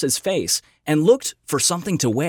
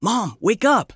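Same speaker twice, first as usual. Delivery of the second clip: urgent call, raised and higher; demanding, louder; 7 semitones higher, slower than usual. A narrator's reading. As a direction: wake her up with a loud demand. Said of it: "he demanded, this time a little louder".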